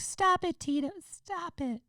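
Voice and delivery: squeaky falsetto pop-star impression; hammed-up sky-high singer's voice. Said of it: "it was like [name]".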